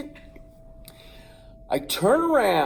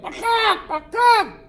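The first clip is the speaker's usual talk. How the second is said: much higher, louder screeching bakak; rooster screech; 7 dB louder than usual. Retold or imitated. The rooster screeches while clawing the boy's head. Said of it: "the Terminator's like".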